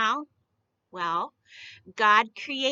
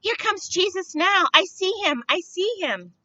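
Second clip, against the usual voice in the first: higher, eager calls; a man in the crowd shouting excitedly; 7 semitones higher than usual. A narrator's reading. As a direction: point and cry out you see him. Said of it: "shouted a man".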